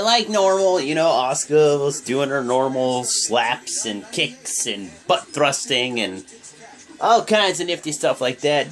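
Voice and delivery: normal talking voice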